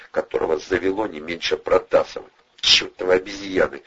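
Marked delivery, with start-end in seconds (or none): none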